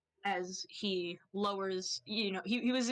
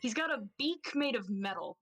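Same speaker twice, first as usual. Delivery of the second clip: ordinary delivery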